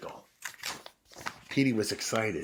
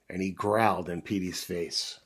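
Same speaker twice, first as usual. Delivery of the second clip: ordinary delivery, nothing marked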